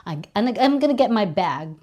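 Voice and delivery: American accent, "bag" with a flat æ. Shown as a demonstration. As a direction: put on an American accent for the whole line. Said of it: "you have to say". (Amerikanong accent)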